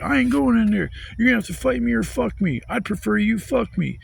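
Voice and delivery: high, whiny taunting voice; mock defiance. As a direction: imitate a drunk balking at the cops. Imitates drunk taunting cops.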